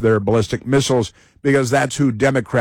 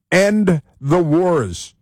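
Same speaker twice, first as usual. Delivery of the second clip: very slow, higher-pitched proclamation; mock slogan; 4 semitones higher, much slower than usual. Host quoting protester's demands, slow mock proclamation. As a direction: draw out each word like a slogan. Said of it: "he says".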